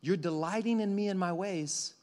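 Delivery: a hint of a soothing God; tender father voice. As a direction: speak as a gentle loving father. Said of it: "God looks at you and says".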